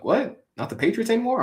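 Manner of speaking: high, disbelieving question; mock surprise. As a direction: act shocked at his dad's switch. Son surprised at dad switching.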